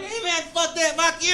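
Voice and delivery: mocking an angry driver cutting in; road rage. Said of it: "someone will cut you off".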